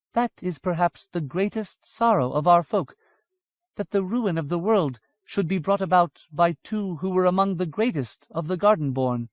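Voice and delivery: lightly voiced solemn elf lady; sorrowful elf woman. Aditu speaks sorrowfully.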